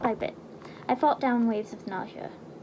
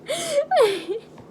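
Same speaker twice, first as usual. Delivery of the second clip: high laugh; 14 semitones higher, 5 dB louder than usual. Real, not acted. Reader cracks up.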